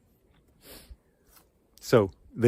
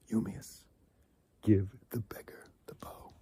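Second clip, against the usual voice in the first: whisper; 2 semitones lower, 12 dB quieter than usual. Acted. Telemachus repeats command quietly.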